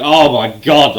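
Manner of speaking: loud, emphatic "oh my God"; amazed reaction. Retold or imitated. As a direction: blurt out thrilled disbelief. His reaction to a savage line.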